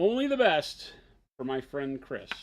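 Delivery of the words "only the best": high, loud and showy; belted out. Real, not acted. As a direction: belt it out high. Repeats line, then belts it.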